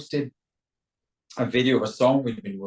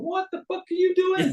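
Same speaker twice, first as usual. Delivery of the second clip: high-pitched indignant outburst; mad, voice goes up. Shown as a demonstration. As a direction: let the voice shoot up as if mad. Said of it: "if you're mad at somebody".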